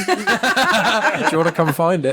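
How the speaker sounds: high, squealing laughter through the words; real helpless giggles, pitch shooting up; laughing hard. Real, not acted. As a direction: barely get the joke out for laughing. Cracking up at running innuendo joke.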